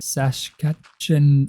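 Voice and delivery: Saskatchewan drawn out slowly, syllable by syllable; slow spelling out. Real, not acted. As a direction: sound out Saskatchewan slowly, unsure. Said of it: "trying to remember how to write".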